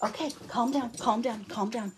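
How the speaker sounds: soft, hushed soothing of excited dogs; calming the dogs. Real, not acted. Crafter hushing her barking dogs, soft and soothing. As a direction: settle the dogs down gently.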